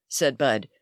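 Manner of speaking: ordinary delivery, nothing marked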